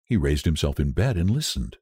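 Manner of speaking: plain reading voice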